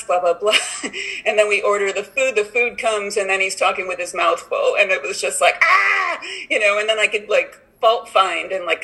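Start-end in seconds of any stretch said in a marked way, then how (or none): 5.6-6.2 sharp high exasperated "Ah!"; a sudden pitched-up cry of irritation; frustrated yelp. Retold or imitated. Irritated by friend eating open-mouthed.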